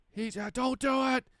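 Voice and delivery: high, loud panicked scream. Retold or imitated. Retelling a bad Salvia trip to the host, screaming. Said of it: "I was screaming at them".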